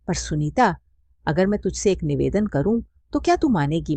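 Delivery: ordinary delivery, nothing marked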